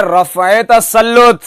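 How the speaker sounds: रफ़े ending in a linking e; correct pronunciation. Shown as a demonstration. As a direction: say रफ़े with -e joined to तसल्लुत. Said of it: "एक लफ्ज चलता है".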